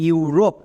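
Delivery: ইউরোপ blended into one word for students; ইউরোপ pronounced clearly as a teaching example; model pronunciation. Shown as a demonstration. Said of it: "একসঙ্গে উচ্চারণ করো".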